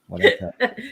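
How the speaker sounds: gloating, sing-song and high; told you so. Real, not acted. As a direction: rub it in playfully. Gloating repeat, louder and higher.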